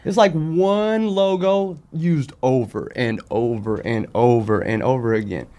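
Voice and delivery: normal talking voice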